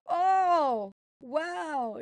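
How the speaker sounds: "oh" fuerte, agudo y alargado; asombro exagerado. Retold or imitated. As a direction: exclama con asombro teatral, bajando en el "wow". Reacción actuada a la videollamada. (asombro actuado a todo volumen)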